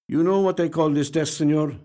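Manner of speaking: a hint of a weary Spanish detective. A narrator's reading.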